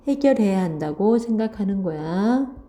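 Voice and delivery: ordinary talk, nothing marked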